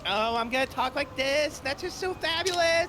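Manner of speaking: high, gushing camp voice, a bit loud. Acted. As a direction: put on an over-the-top fabulous voice. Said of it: "flaming homosexual".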